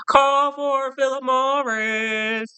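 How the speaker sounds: put-on high, slow old-time announcer call; drawn-out bellhop call; old radio ad call. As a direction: sing it out like a vintage cigarette ad. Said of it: "it's giving me I Love Lucy".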